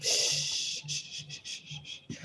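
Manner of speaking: soft unvoiced shushing; hushing. Real, not acted. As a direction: shush yourself quietly. Hushing herself after laughing.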